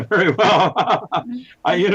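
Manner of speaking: laughing. Real, not acted. Laughs at own failure.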